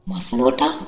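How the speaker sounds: shocked disbelief at the word; stunned question. Acted. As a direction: pitch jumps high in shock. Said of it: "मी अवाक होऊन विचारले".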